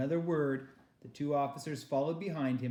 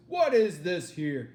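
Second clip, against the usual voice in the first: officer demands; 4 semitones higher, 9 dB louder, slower than usual. Acted.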